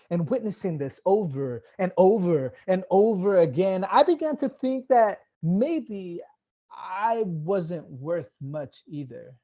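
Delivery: driving, emphatic public speech; impassioned. Real, not acted. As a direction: let the frustration swell with each repetition. Passionate speech, frustration builds.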